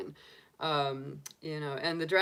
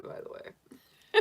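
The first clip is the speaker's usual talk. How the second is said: high, breathy whispered dragon aside, slow; funny dragon voice; 3 dB louder, slower than usual. Acted. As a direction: hiss it out like a playful little dragon. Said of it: "That is my dragon name".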